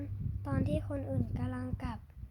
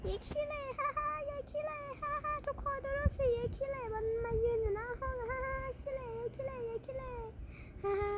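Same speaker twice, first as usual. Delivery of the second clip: much higher cackling taunt with laughs; mocking laughter; 10 semitones higher than usual. Classmate mocking the girl at the front, laughing. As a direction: laugh at her in front of everyone.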